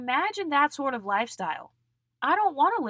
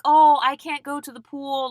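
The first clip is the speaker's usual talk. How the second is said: loud, higher mimicked whine of excuse; mock complaint; 5 semitones higher, 8 dB louder than usual. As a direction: imitate someone complaining, loudly. Mimicking stressed belugas' complaint.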